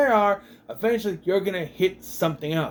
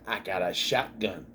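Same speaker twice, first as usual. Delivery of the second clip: mimicking the character; 9 semitones lower, 6 dB quieter than usual. Mimics Ryan with his shotgun.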